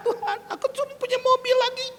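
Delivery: high, fast mock whimper; whining plea. Preacher tells God only the car is left, whining.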